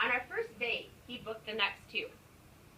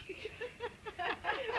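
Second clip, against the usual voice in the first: high giggle; 12 semitones higher, 4 dB quieter than usual. Real, not acted. Breaks into laughter reading.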